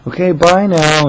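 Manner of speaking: high, loud, slow sign-off; overly polite. Retold or imitated. Hanging up on the caller as nicely as possible, overly polite. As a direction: draw out a sweet goodbye. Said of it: "trying to be as polite as possible".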